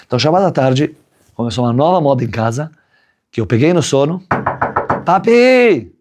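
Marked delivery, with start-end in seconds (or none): 5.0-5.9 put-on high child's call of papi. Child waking father from nap, high call. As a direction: shout for dad like a small kid.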